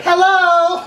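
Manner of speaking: high, comic answering call; hammed-up high hello; woman coworker. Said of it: "Charlie was also a woman".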